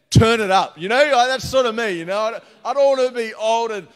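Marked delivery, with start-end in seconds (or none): 0.1-0.8 loud, high yell at the sound man; playful yell. Pretend. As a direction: yell it out like a rowdy concertgoer. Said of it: "yelling at the sound man".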